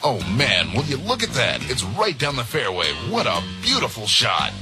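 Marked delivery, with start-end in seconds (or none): none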